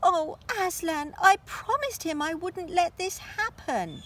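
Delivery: high pleading anguish; distressed girl. Acted. Lucy begs Aslan, high and distressed. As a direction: plead with a catch in the voice.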